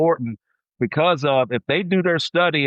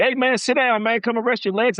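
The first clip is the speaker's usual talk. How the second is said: raised-pitch imitation of coaches calling over; imitating coaches; 10 semitones higher than usual. Coaches telling a player to sit down, imitated high call. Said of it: "my coaches telling".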